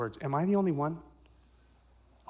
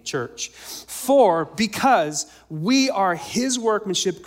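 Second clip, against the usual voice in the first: slow, much louder, higher scripture proclamation; preaching at full force; 6 semitones higher, 7 dB louder, slower than usual. Acted. Pastor booming Ephesians to the church, slow and loud. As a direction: proclaim each phrase slowly to the rafters.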